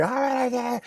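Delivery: mock cries.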